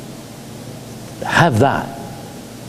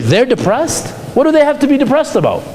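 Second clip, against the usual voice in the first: dismissive disbelief; 11 semitones higher than usual. Retold or imitated. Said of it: "Somebody's like".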